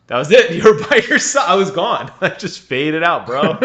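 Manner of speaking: loud laughter through the words; laughing. Real, not acted. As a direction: laugh while telling it. Laughing after co-host cut out.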